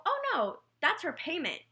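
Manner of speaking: high, amused "oh no"; surprised teacher. Retold or imitated. Teacher telling the worried mom the coupons are payment, high and surprised. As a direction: pitch way up, as if the mix-up is obvious.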